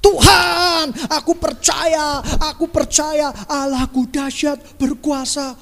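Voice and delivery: drawn-out, imitated yelling declaration; mock shouted prayer. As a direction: call out to God with hammed-up fervour. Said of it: "berteriak-teriak".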